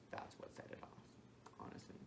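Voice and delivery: unvoiced whispered aside. Real, not acted. Confiding to viewers in a whisper. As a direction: drop to a whisper.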